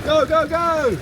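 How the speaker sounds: high excited cheering. Real, not acted. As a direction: cheer her on to the finish. Cheering runner on at finish.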